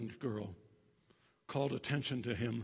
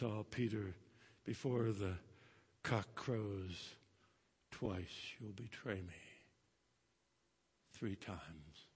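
much softer, lower, tender; gentle remembered words; 4 semitones lower, 10 dB quieter, slower than usual. Acted. Jesus foretelling Peter's betrayal, soft and tender. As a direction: let it come back like a whisper of memory.

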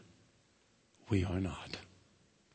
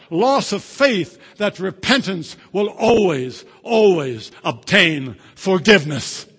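much louder, high, emphatic preaching; preaching at full force; 8 semitones higher, 18 dB louder than usual. Acted. Preacher proclaiming the book's words to the congregation, loud emphatic. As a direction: thunder the quote to the congregation.

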